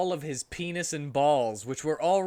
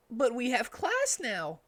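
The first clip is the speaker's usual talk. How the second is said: put-on higher boyish voice; mild protest in a young voice; Tails voice; 9 semitones higher than usual. Tails reminding Cream about class, high voice. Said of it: "said Tails to Cream".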